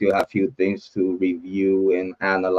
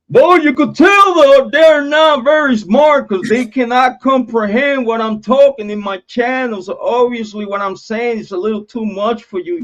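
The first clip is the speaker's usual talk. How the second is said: much higher, louder, taunting put-on voice; mocking doubters; 13 semitones higher, 5 dB louder than usual. Streamer mocking doubters who can't follow his channel, high put-on taunting voice. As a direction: sneer at the haters in a squeaky higher voice.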